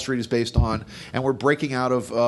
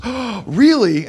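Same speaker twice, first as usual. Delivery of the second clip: high, slow, scandalized "Oh, really?"; shocked gasp; 10 semitones higher, 7 dB louder, much slower than usual. Retold or imitated. Wife hearing about the mistress, high shocked drawl. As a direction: act out the wife's shock, loud and drawn out.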